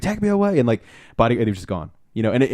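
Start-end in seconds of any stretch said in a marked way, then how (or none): 0.0-0.6 high sung-out cry; high wailing rock yell. Retold or imitated. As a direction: belt it high like a stage exit. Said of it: "He was like".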